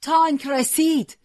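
slow, high, loud alarm cry; shouting. Acted. Boy warns the fighter the tank has arrived, shouting. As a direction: yell the warning with all you have. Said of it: "دوباره داد کشید".

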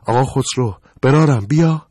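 calling out. Acted. Calling him back at door.

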